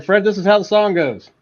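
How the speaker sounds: laying down the law. Retold or imitated. Bandmate instructing his producer, playfully bossy. Tells producer how song goes.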